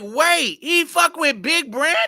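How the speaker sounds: shocked disbelief. Retold or imitated. Hearing Vincent dated Big Brandy: a loud, high, shocked blurt.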